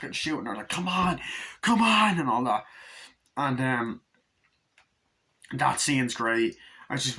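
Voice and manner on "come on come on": high-pitched goading cry; hammed-up battle urging; acted urgent yelling. Hunters shooting at the bride, acted urgent yelling.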